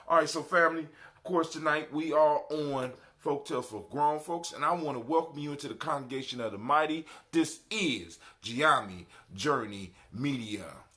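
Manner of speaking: big radio MC welcome; hype radio host. Acted. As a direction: pump up the crowd like a live DJ. Hyped host announcing the show.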